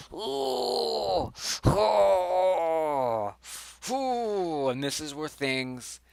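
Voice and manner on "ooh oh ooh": high, loud dramatic ooh of shock; loud ooh at a juicy scandal. Real, not acted. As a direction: groan out a stunned ooh. Reacting to the cheating messages.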